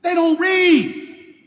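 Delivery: slow emphatic preacher shout; preaching shout. Real, not acted. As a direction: drive each word home to the congregation. Preacher scolding other readers.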